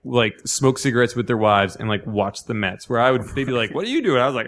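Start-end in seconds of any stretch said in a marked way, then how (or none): 3.8-4.2 gruff coworker. Retold or imitated. Coworkers quoted questioning him.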